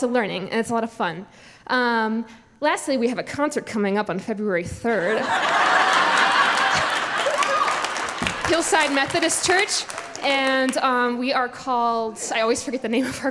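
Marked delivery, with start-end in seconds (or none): 5.2-8.4 loud unvoiced giggle; breathy high laugh. Real, not acted. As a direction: burst out laughing. Laughs after concert mention.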